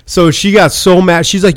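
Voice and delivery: usual speaking voice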